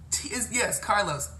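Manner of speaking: ordinary talk, nothing marked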